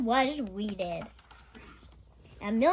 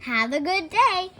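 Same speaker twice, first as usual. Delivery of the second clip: slow, higher, louder cheerful goodbye; Mister Rogers; 7 semitones higher, 10 dB louder than usual. A narrator's reading. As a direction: call it out warmly to the girl. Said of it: "said Mister Rogers".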